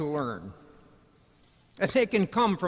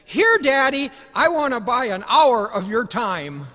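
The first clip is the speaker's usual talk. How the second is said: put-on higher and louder, earnest little boy; boy eagerly paying for his dad's time; eager child; 5 semitones higher, 8 dB louder than usual. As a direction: push the money at him, eager. Son offering money to father.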